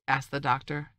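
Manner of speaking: plain reading voice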